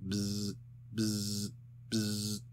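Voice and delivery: soft, distant buzz buzz; buzzing phone ring. A narrator's reading. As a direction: imitate a phone ringing far off. Phone ringing on the line.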